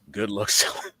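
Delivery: quoting the threat while breaking into laughter; can't keep it straight, laughing through the words; laughing through a quote. Retold or imitated. Mocking the president's toy threat to friends, laughing.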